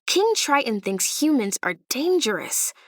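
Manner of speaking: ordinary delivery, nothing marked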